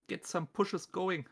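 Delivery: ordinary talk, nothing marked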